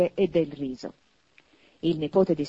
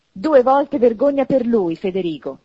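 loud, higher, fired-up reproach; heated exclamation; 4 semitones higher, 7 dB louder than usual. A narrator's reading. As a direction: exclaim it hotly as the niece. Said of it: "esclamò tutta accesa la nipote".

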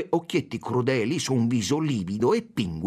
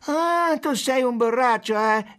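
very high, loud crowing; mocking exclamation; 13 semitones higher, 5 dB louder, slower than usual. A narrator's reading. First policeman mocking the consul as a drunk, high and loud. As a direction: pounce on it with a jeering squeal.

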